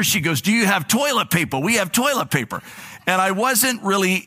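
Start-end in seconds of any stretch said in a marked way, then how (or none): 0.4-2.6 lightly voiced New York clerk, higher and eager; a hint of a New York accent, pitched up; imitating New York accent. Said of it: "she has this very thick".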